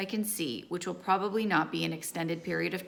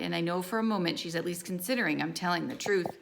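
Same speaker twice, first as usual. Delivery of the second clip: plain reading voice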